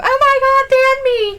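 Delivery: high, loud mock wail; mock outrage. Mocking someone who bans over harmless words, mock outrage. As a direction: shriek it in exaggerated horror.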